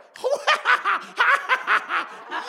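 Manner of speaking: a sudden, very high laughing whoop; high burst of laughter. Real, not acted. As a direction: laugh out loud in delight.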